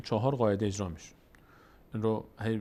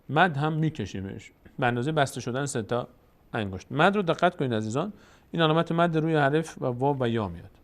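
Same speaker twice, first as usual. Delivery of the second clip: normal talking voice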